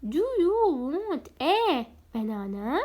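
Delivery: slow, stretched question rising at the end; slow classroom question; slow model question. Shown as a demonstration. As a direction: read slowly so pupils can repeat. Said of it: "خلينا نسأل سؤال ثاني".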